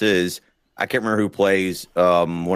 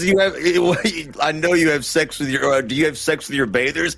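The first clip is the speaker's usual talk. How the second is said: imitating the king, laughing; 6 semitones higher than usual. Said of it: "he says".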